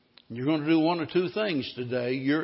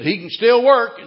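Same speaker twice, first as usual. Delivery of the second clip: mock protest; 7 semitones higher, 10 dB louder than usual. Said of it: "Joe said".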